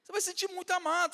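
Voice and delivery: high, loud preaching cry; preacher promises the congregation they will feel loved, high and loud; preaching climax. Acted. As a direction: lift the whole church with it. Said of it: "preacher's climax to the congregation".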